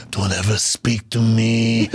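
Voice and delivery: low, slow menacing villain; slow villainous threat. Retold or imitated. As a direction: draw it out low, as a dark warning.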